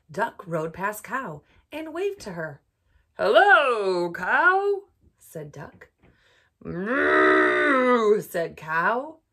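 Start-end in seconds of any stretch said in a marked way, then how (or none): 3.2-4.9 cheerful duck greeting. Acted. Duck greets cow in story. 6.6-8.2 loud cow moo. Acted. Cow voiced in story.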